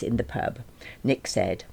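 usual speaking voice

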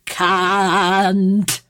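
slow, drawn-out mock posh English accent; Mrs Overall accent. Friend on stage drawls the line in Mrs Overall accent. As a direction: stretch it out like a grande dame of English comedy. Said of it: "came on stage like Missus Overall".

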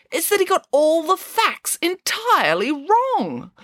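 high, slow, outraged complaint; theatrically appalled; indignant. A narrator's reading. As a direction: stretch it out in high-pitched indignation. Indignant critic of the play.

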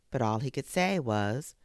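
plain reading voice

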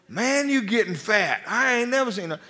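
a light impression of a joking good old boy; country boy greeter. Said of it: "trying to be a good old country boy".

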